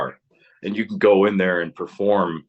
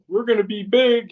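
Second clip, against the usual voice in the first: much higher, hammed-up boast; mock grandiose teen; 14 semitones higher than usual. Teen bragging about the band's future, hammed-up boast. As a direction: lampoon a kid's big dreams.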